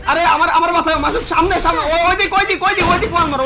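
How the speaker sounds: high, loud urgent callout; urgent shout. Real, not acted. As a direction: yell the warning to a teammate. Enemy above, urgent callout.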